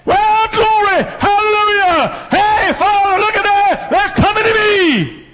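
high, loud joyful shouting of glory; Jesus having a spell. Retold or imitated. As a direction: shout it out like Jesus rejoicing in heaven. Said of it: "I can hear him".

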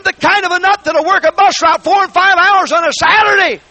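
high, loud preaching shout building to the peak; full-force preaching. Acted. Preacher shouting to the church about sold-out believers, full force.

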